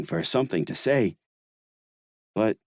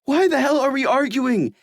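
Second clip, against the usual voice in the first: high, loud tearful yell; 4 dB louder than usual. Acted. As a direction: yell back through tears. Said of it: "he yells back".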